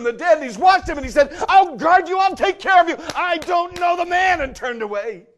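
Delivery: high, loud, fast sermon climax; fervent shouting preacher; preaching at full force. Acted. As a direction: drive it home to the congregation.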